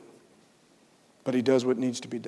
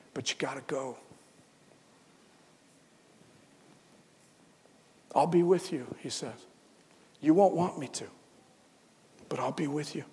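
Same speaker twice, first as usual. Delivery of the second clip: soft, quiet reassurance; tender promise; 5 dB quieter than usual. Acted. God promising Adam and Eve he will stay with them, softly. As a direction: comfort them as they leave.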